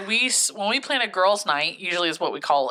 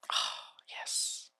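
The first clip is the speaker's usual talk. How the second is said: whispering; 14 dB quieter than usual. Real, not acted. Whispered aside during the chat.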